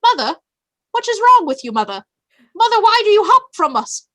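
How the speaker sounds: put-on high, loud, fast British-accented plea; frantic little rabbit calling for mother; British bunny voice. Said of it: "Stuart hops up and he's like".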